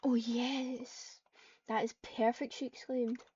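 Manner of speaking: usual speaking voice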